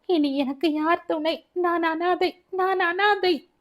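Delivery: voiced crying woman, pitched well up; tearful wailing. A narrator's reading. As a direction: plead in helpless, weepy despair. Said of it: "மாயாவின் அழுகை அதிகமானது".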